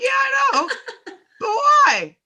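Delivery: high, loud, laughing But why; laughing exasperation. Retold or imitated. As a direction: push back laughing in disbelief. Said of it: "I'm like".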